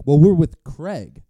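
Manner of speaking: loud, slow mocking voice; mock guests. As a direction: drag it out like smug guests. Guests explaining themselves.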